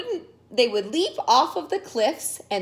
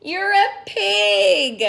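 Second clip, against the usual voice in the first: exclaiming; 8 semitones higher, 6 dB louder, slower than usual. Acted. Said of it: "cried Caramba".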